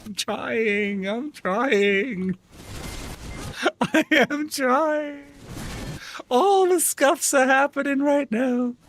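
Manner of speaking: strained, drawn-out whine breaking into laughter; laughing in exasperation; helpless laughing whine. Real, not acted. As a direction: whine through the giggles. Helpless laughing whine over glitches.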